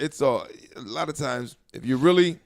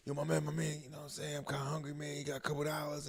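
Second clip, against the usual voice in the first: much softer, pleading mumble; quiet begging voice; 2 semitones higher, 11 dB quieter than usual. Retold or imitated. Imitating a panhandler asking.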